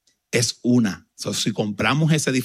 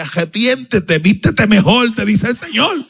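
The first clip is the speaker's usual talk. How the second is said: higher, louder prophetic proclamation; mock prophecy; 9 semitones higher, 7 dB louder, slower than usual. Pastor imagining the prophecy scolding his clothes, loud and high. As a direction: ham up a stern prophetic rebuke. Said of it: "Y yo decía".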